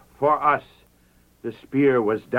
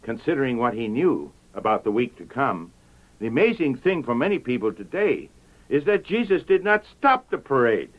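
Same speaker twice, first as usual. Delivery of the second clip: normal talking voice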